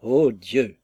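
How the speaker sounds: a touch of rapturous sigh; lover's exclamation. A narrator's reading. Jones exclaiming over Sophie, rapturous. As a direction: breathe it out in mild rapture. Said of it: "s'écria Jones".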